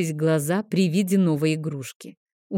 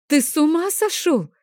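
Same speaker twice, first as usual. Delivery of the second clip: much higher, louder furious cry; roaring outrage; 10 semitones higher, 4 dB louder than usual. Acted. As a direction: bellow it at him in shock. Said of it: "взревела я". (гневный громкий крик)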